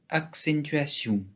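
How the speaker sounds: "accentuation" slow, in Gascon, final vowel stressed; slow Gascon "accentuation" with end stress; stress on last vowel. Shown as a demonstration. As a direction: spell out each syllable of "accentuation", landing on the last. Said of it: "l'accent tombe sur la dernière voyelle".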